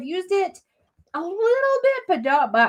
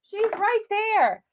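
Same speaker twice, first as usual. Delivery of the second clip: excited recognition; 10 semitones higher, 3 dB quieter than usual. Retold or imitated. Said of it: "I was like".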